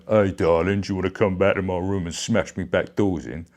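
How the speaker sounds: lightly voiced blokey chat-up line; a hint of a leering old geezer. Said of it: "sound like a proper old geez like me".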